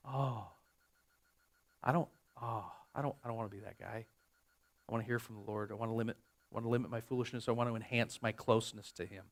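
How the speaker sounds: very soft, low-pitched personal plea; hushed confession. Real, not acted. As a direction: confess it quietly, almost to yourself. Confessing his own longing to God.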